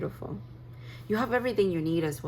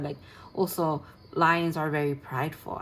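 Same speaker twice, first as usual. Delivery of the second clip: ordinary delivery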